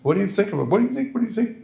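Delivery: put-on high, swallowed English accent; Berlin imitation. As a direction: mimic a mumbling Oxford don. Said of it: "I'll try to".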